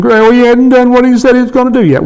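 high-pitched whining impatience, not a real scream; mock begging complaint. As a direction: imitate someone pestering with a high, insistent complaint. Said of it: "begging and screaming".

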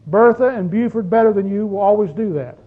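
usual speaking voice

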